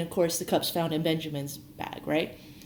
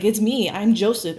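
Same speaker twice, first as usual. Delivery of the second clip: loud, raised-pitch reveal; retold emotional outburst; 5 semitones higher, 8 dB louder than usual. Joseph revealing himself to his brothers, retold as a loud outburst. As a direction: blurt it out loudly, imitating an overcome man. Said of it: "like, just weeping and bawling".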